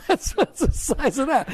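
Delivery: high, loud laugh through the words. Real, not acted. Laughs at his own point.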